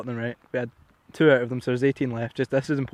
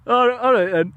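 high, loud laughter breaking the quote; laughing through it; 12 semitones higher, 8 dB louder, slower than usual. Real, not acted. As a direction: laugh while retelling the awkward reply. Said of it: "most bullshit excuse ever".

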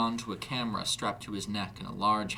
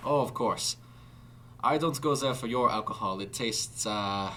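put-on German accent; 3 semitones higher, 4 dB louder than usual. A narrator's reading. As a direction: protest in a German accent, then search for the word. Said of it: "a German accent now readily apparent".

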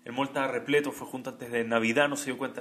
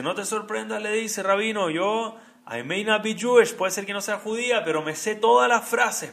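woman's voice; 6 semitones higher, 7 dB louder than usual. Retold or imitated. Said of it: "voz de la mujer".

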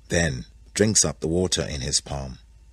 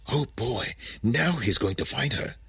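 higher, softer conspiratorial bear voice; hushed whispering; 5 semitones higher, 5 dB quieter than usual. A narrator's reading. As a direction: whisper it like a spy in hiding. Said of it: "They are whispering".

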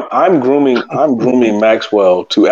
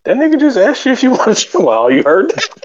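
mocking friend; 12 semitones higher than usual. Mark quoted mocking on phone.

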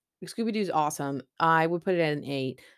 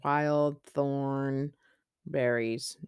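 very slow drawn out; 2 semitones lower, 3 dB quieter, much slower than usual. Acted. Drawn-out title.

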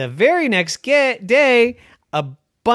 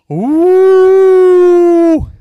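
ghost moan; 13 dB louder than usual. A narrator's reading. Ghost moan heard by Mickey.

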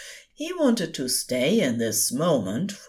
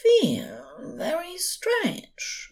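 slow, high, sleepy angel voice slurring; drowsy slur; 3 dB quieter, much slower than usual. A narrator's reading. Drunk angel drifting off on the couch, slurring. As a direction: let the words slide into sleep. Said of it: "voice starting to slur".

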